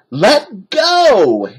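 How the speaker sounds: high, loud demand; Annie shouting. A narrator's reading. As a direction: shout into his face. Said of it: "Annie shouted".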